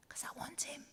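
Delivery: breathy secret whisper; whispered confession. Acted. Emily whispers her real reason for saying yes, hushed. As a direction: lean in and whisper it. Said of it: "what our bride, Emily, wrote in a diary".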